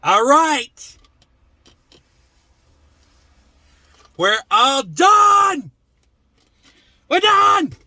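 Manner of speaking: loud, high-pitched shout; skit yelling. Acted. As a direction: yell it out, drawing out the words. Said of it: "I was only yelling".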